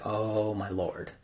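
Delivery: soft, slow, drawn-out dismay; hushed shock. Retold or imitated. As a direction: let it out slowly and quietly, imitating shock. Cindy hears the shocking news.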